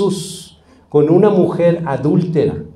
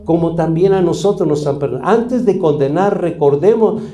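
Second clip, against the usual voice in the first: raised, insistent sermon delivery; fervent preaching; 4 semitones higher than usual. Acted. Pastor exhorts the congregation to forgive, preaching with force. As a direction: drive the call to forgive home.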